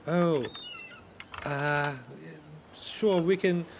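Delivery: an overly sweet host; high sugary NPC voice. Acted. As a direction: put on a bright fake-nice voice.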